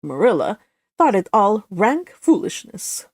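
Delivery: plain reading voice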